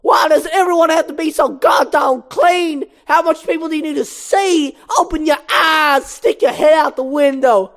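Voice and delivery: high, slow, exasperated comedian rant; yelling. Acted. Moose Blood yelling at truck driver through the glass. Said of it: "yelling at the truck driver through the glass".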